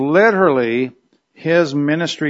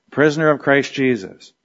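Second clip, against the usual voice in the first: usual speaking voice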